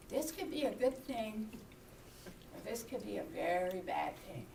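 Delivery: low, soft inner voice; thinking to oneself. Retold or imitated. Lost walker alone in the street, thinking quietly. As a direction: quietly weigh it up in your head. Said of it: "I was standing in the middle of the street thinking".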